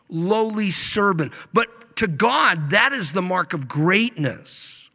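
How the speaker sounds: ordinary talk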